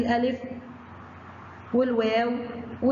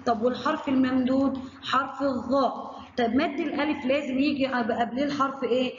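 normal talking voice

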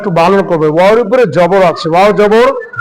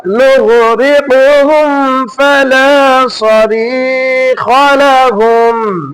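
slow, higher melodic tajweed chant; Quran recitation; 5 semitones higher, much slower than usual. Acted. Teacher chanting the verse for the student to repeat, slow and melodic. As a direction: draw each word out in a recited melody.

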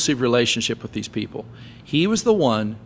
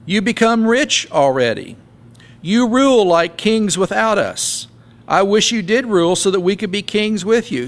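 higher-pitched mock praise; sarcastic; 6 semitones higher than usual. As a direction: lay the flattery on with open sarcasm. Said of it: "Paul gets sarcastic here".

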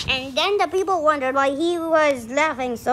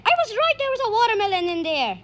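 high, gleeful outburst; excited; 6 semitones higher, much faster than usual. Real, not acted. Reader delighted at guessing the watermelon, excited. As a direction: burst out with a high squeal, then race on. Child's guess proves right.

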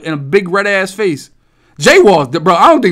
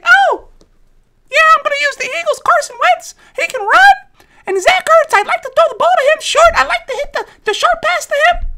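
put-on very high squeaky kid, eager and quick; kid voice imitation; 4 dB louder than usual. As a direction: play the excited little boy explaining plays. Imitating young Salty talking Madden.

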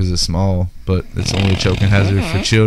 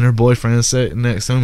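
ordinary delivery

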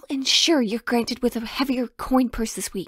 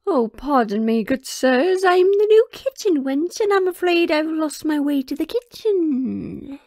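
pretend kitchen wench; 3 semitones higher, 4 dB louder than usual. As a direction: play the lost new kitchen girl, a touch put on. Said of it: "I'll know exactly what to say".

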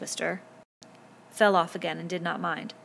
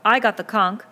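loud, raised-pitch protest; forceful, affronted claim; indignant protest; 4 semitones higher, 10 dB louder than usual. A narrator's reading. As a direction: insist on your turn. Indignant boy defends his turn.

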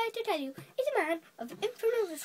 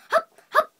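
loud, high hiccups; two sudden yelped hiccups; acted hiccups; 12 semitones higher, 11 dB louder than usual. A narrator's reading. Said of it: "he's making me hiccup".

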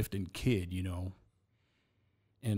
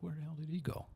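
muttering; 6 semitones higher, 10 dB quieter, faster than usual. Retold or imitated. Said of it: "I'm like".

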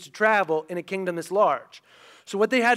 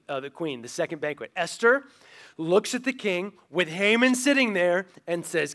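normal talking voice

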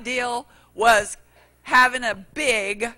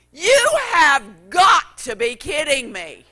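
loud, slow, laughing mock-angry shout; exasperated outburst; 4 semitones higher, 9 dB louder than usual. Preacher retelling his outburst at the cashier, loud mock fury. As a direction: blow up in hammed-up disbelief. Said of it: "Being the godly, understanding, peace-loving person".

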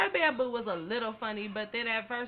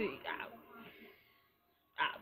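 usual speaking voice

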